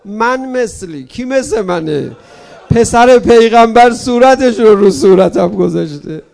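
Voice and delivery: slow, then a loud cry of pride; hammed-up last breath, crying out to the congregation; dying slave's cry.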